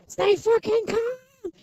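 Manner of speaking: high, drawn-out hammed-up yell; mock panic. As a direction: imitate a meme panic, stretching the last word. Acting out a panic meme.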